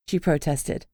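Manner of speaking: ordinary delivery, nothing marked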